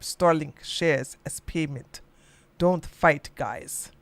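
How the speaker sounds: put-on a modest Russian accent reading the statement; a hint of Russian accent, low and fading at the ends. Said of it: "let me see if I can do a Russian accent".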